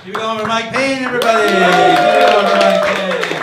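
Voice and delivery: high and loud, stretching the name out; rally the crowd for the comic; host hype. Real, not acted. Host brings up applause for the comic, loud hype call.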